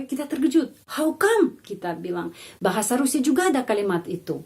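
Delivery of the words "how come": startled rising how come; surprised. Shown as a demonstration. Teacher demonstrates a surprised question. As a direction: sound caught off guard by bad behaviour.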